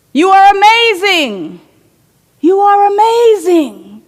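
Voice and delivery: high ringing call and its echo. Acted. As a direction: call it across the valley. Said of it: "yells back the mountain".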